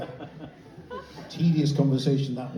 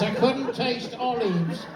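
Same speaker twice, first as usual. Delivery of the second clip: higher, drawn-out mock complaint; tedious covid sufferer; 5 semitones higher, slower than usual. Someone overheard on losing taste, slow mock woe. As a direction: stretch it slowly in a higher voice. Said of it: "I actually heard somebody say this".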